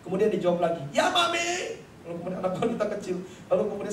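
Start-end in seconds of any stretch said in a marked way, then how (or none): 0.9-1.8 put-on very high-pitched little girl calling back; high, piercing mock-child reply; child's loud answer. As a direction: imitate a child answering far too loudly. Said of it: "suaranya kenceng banget".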